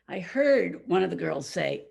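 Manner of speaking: ordinary delivery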